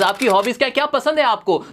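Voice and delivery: normal talking voice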